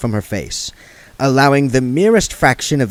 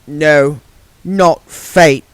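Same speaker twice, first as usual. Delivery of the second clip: darkly; 6 semitones higher, 6 dB louder, much slower than usual. Acted. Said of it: "said Margaret darkly".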